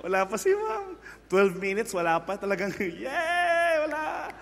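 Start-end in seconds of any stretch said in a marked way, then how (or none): 0.1-0.9 giddy student. Pretend. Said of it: "students waiting for teacher". 3.0-4.3 mock student cheer. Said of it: "students cheering teacher's absence".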